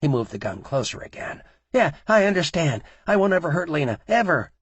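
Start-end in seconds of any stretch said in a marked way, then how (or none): 1.7-4.5 very high, loud scared yielding; frightened agreement. A narrator's reading. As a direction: give in, voice pitched up with fear. Terrified man promising at gunpoint.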